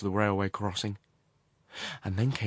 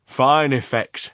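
loud, slow, higher; grand exclamation; 5 semitones higher, 10 dB louder, slower than usual. A narrator's reading. Waving his arm at the view, grandly loud. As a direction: announce it with slow relish.

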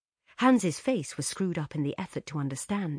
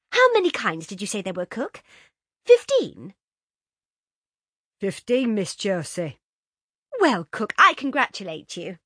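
put-on higher, louder girlish enthusiasm; Josie's voice; 5 semitones higher, 9 dB louder than usual. A narrator's reading. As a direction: flatter the cook brightly. Josie praising cook.

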